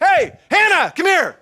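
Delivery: high, loud happy shout across a room; a father calling his daughter over, loud and glad; dad calling out. Retold or imitated. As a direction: call her over with delight. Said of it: "He'd be like".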